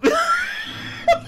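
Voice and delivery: high, helpless laughter; real laughing fit. Real, not acted. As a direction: break up before you can finish. Cracks up at own confession.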